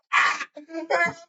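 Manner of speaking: breathless high wheezing laugh. Real, not acted. Laughing at the story's punchline, high wheezing laugh. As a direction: let out a helpless high wheeze.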